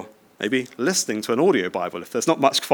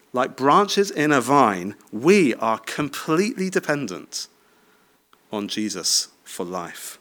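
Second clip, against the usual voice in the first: slow emphasis; slower than usual. Real, not acted. Solemn point of the sermon.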